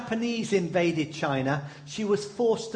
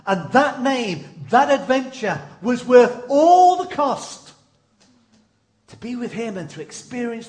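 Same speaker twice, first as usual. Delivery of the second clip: building climax; 6 semitones higher, 7 dB louder than usual. Real, not acted. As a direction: swell to a triumphant peak.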